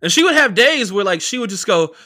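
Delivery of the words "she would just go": ordinary talk, nothing marked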